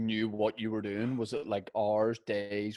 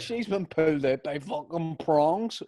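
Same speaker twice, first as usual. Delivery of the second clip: slow, higher, louder mock of a crude midwife; 5 semitones higher, 4 dB louder, slower than usual. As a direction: parody the rude midwife, drawn out. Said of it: "this midwife sounds well unprofessional".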